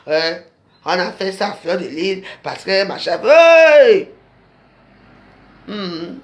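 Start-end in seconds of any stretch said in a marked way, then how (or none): 3.2-4.1 a sharp loud hey to grab attention; sudden shout. Real, not acted. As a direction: call out suddenly, loud and high. Sudden call-out to audience. (interpellation soudaine et forte)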